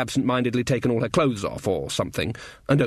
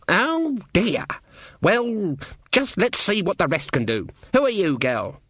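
unimpressed Granny witch; 7 semitones higher than usual. Acted. Granny seeing what the rest can do, unimpressed. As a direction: dismiss one girl and summon the next. Granny disappointed, moves to next girl.

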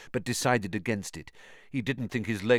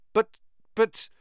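panicked stammer; 13 semitones higher, 5 dB louder than usual. A narrator's reading. Harry panicking.

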